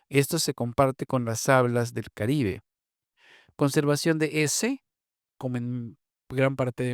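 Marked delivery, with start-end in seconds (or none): none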